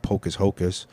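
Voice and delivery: "pocus hocus" with the two words swapped; wrong word order. Shown as a demonstration. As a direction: say "pocus hocus" as the mistaken flip.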